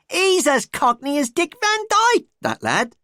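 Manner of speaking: high, loud mock cockney; exaggerated cockney proclamation; hammed-up cockney. A narrator's reading. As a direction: lay the cockney on thick. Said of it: "common or garden English accent".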